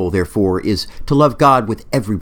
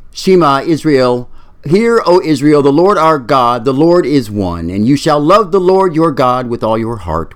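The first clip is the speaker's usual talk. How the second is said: prayer recitation; 3 semitones higher, 4 dB louder than usual. Acted. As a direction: recite the Shema reverently. Man reciting the Shema.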